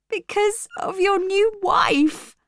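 high, drawn-out distressed answer; slow, high-pitched acted hurt; upset reply. A narrator's reading. Jane tells Rochester why she must leave, upset.

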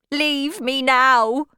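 high, loud, drawn-out order; cried command. A narrator's reading. Wife ordering suitor to leave, urgent cry. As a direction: cry it out slowly, desperate to be left alone. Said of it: "she cried".

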